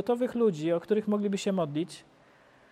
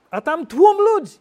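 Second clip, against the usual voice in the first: mock surprise; 7 semitones higher, 10 dB louder than usual. Surprise on landing.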